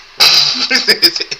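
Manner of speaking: big loud high laugh of frustration; loud exasperated laugh. Real, not acted. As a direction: burst out laughing at the mess. Laughing at failed fix.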